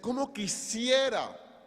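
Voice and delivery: high, slow, stretched lament; acted cry of longing; mock tearful plea. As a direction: plead it out without sobbing. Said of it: "Jesús está llorando".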